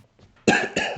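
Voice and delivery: dry cough from dust. Real, not acted. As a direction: clear the dust from your throat with a cough. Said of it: "Got some dust in my throat".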